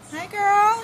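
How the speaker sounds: very high cooing greeting; pet talk. Real, not acted. Owner greeting a hen in a high voice. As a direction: sing it to the hen.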